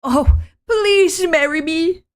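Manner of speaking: put-on high, pleading young woman; anime heroine voice. Reads female lead's lines.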